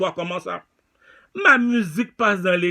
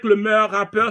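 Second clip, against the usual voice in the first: ordinary talk, nothing marked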